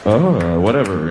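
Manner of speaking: low, half-hearted moan; bored moan. Pretend. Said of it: "holding pattern bullshit moan".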